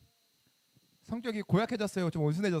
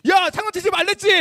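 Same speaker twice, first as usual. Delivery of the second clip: acted furious yell, much higher and louder; bad-tempered scolding shout; 11 semitones higher, 12 dB louder than usual. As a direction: explode at the kids, playing a hot-tempered pastor. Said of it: "이러면 성격 고약하면 어떡해요?". (성질내며 크게 호통치는 연기)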